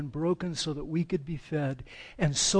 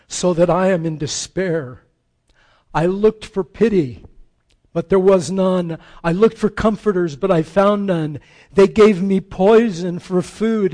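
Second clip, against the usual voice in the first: louder, crying out the psalm in anguish; 8 dB louder than usual. Acted. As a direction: proclaim the lament with full force. Reciting the Messiah's cries.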